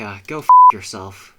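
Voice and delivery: loud furious outburst; angry shout. Real, not acted. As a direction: yell it at the game. Raging at the game.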